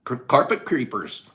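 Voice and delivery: ordinary talk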